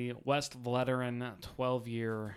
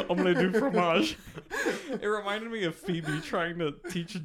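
laughing through the quote; 4 semitones higher, 6 dB louder than usual. Real, not acted. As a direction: giggle it out. Laughing repeat.

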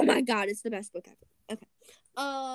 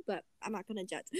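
ordinary delivery, nothing marked

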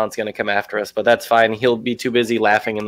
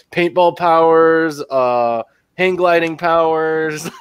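mock-proud boast; 4 semitones higher, 4 dB louder, slower than usual. Mocking a superhero boast.

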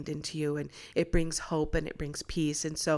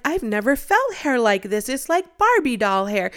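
higher, louder, gushing over the hair; amazed neighbor; 6 semitones higher, 7 dB louder than usual. Retold or imitated. Neighbor gushing to the mother about the child's hair, excited. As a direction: marvel at it like touching a doll's hair. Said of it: "And she's like".

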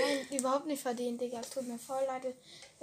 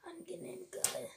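whisper; 4 semitones higher, 9 dB quieter than usual. Real, not acted. Whispered reply during foot massage.

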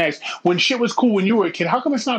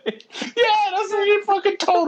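still laughing; 13 semitones higher, slower than usual. Real, not acted.

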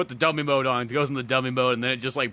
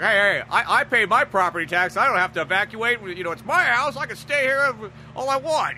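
higher, louder indignant protest; stubborn homeowner; 9 semitones higher, 6 dB louder than usual. Retold or imitated. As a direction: insist like an entitled homeowner. Stubborn homeowner refusing evacuation.

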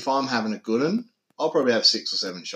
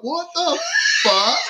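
much higher, outraged exclamation; shocked what the fuck; 4 dB louder, slower than usual. Retold or imitated. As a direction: react to spew all over the hallway. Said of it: "she was like".

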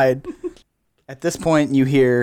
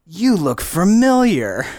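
lightly voiced suspicion, slow and raised in pitch; suspicious Belle; 6 semitones higher, 4 dB louder, slower than usual. Belle eyeing the stranger without his bandana, suspicious. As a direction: draw it out, higher, as if placing a face.

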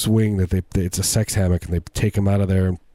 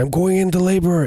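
mock labor cry; 11 semitones higher than usual. Kevin Costner voiced in labor.

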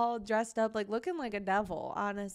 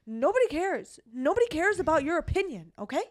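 put-on higher and louder, a snotty little boy; imitating a defiant boy, higher and louder; bratty kid voice; 6 semitones higher, 5 dB louder than usual. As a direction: dismiss them like a sassy child. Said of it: "And he goes".

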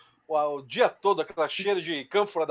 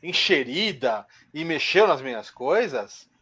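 low, slow, stern rebuke; slow stern scold; 5 semitones lower, much slower than usual. Retold or imitated. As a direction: drag each word like a cold reprimand. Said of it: "ele fala".

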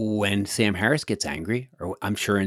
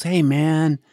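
high, gentle imitation of a big guy calming someone; lightly voiced reassurance; mock soothing; 8 semitones higher, 3 dB louder than usual. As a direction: play the calmed-down trucker talking him down. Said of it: "this huge guy is trying to calm him down".

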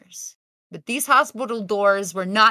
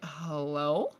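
timid hello; 5 semitones lower, 9 dB quieter than usual. Retold or imitated. Doors open onto darkness.

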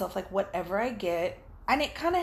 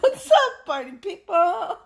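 much higher and louder, laughing; big shouted greeting; 8 semitones higher, 9 dB louder than usual. Real, not acted. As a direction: yell it like a hype host. Greeting repeated bigger.

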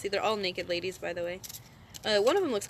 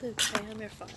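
a lightly voiced Joker saying Vader's line; quiet, put-on Mark Hamill Joker; 5 dB quieter than usual. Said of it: "Joker Mark Hamill impression".

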